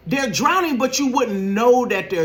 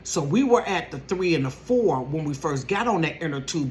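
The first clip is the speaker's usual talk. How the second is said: ordinary delivery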